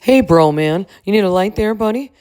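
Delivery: dubbed bro. Acted. One guy offering another a light, chummy bro. Dubbing guys on the bus.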